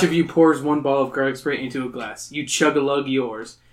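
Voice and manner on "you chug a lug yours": usual speaking voice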